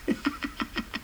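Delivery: wheezy, voiceless chuckling; amused laugh. Acted. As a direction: laugh quietly at your own story. Laughs after the inheritance punchline. (leises, tonloses Lachen)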